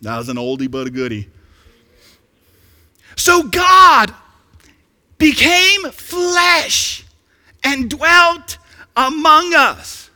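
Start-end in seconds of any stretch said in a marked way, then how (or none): 3.1-10.1 high, loud, drawn-out proclamation; full-force preaching. Acted. Preacher prays the Word becomes flesh, full-force preaching. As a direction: ring out each phrase slowly to the room.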